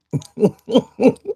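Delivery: short soft laugh rising in pitch. Real, not acted. Streamer laughing at a chat comment, soft rising laugh. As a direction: let a quick soft laugh rise up.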